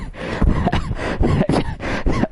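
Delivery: high, squeaky burst of laughter; laughing. Acted. Student breaking up while explaining to sir, in high laughter.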